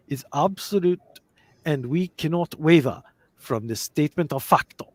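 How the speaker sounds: put-on a touch of a Japanese accent, deliberate and formal. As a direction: quote the prime minister in a light Japanese accent. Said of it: "That is the Japanese accent".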